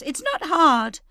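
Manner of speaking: high, loud curt retort; snapping. Acted. Leah snaps at her mother, high and loud. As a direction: fling it back impatiently. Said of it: "Leah snaps".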